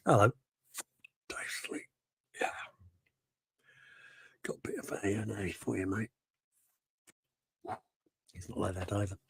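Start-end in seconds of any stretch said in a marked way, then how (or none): 0.1-6.1 much softer, half-whispered greeting; quiet mumbled hello. Retold or imitated. Mimicking the viewer as a soft mumbling mate. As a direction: murmur it like a shy bloke. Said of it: "He goes".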